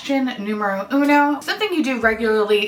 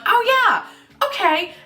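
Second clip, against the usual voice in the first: high-pitched, light "oh yeah"; airy high self-imitation; acted sudden realization; 12 semitones higher, slower than usual. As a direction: pitch it way up as if just remembering. Acts out own sudden remembering.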